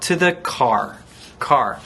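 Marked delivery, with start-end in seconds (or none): none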